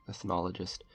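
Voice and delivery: ordinary delivery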